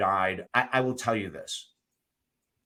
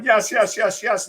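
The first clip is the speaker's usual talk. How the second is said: high, loud, eager yeses; imitated excited freak-out; 9 semitones higher, 8 dB louder than usual. Woman jumps in before the proposal, freaking out excitedly. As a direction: blurt it out before he can ask. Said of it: "she's freaking out".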